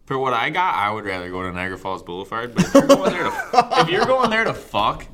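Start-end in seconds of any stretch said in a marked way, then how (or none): 2.5-5.1 laughing. Real, not acted. Laughing at his own story.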